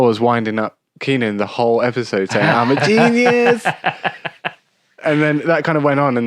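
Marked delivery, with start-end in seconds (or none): 2.5-3.6 much higher, slow goofy boast; mock Kel boast. Kel winding up Keenan, slow goofy boast. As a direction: gloat it like Kel.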